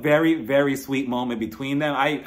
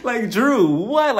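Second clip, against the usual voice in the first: louder, higher what-are-you-doing call; stunned disbelief; 7 semitones higher, 6 dB louder, much slower than usual. Real, not acted. Vlogger calling out Drew on camera, loud disbelief. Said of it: "one second she was boo-hooing".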